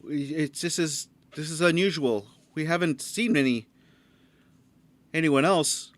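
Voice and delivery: a nervous guard stammering orders. Acted. GM voicing the nervous guard to the strangers, jittery.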